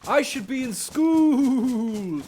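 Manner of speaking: a hint of a defiant schoolgirl; half-hearted impression of a protesting kid; mock Greta. Acted quarrel, Greta's side.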